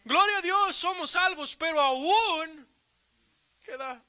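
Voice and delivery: high, ringing praise to God; fervent preaching. Acted. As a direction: lift the church up with a joyful cry.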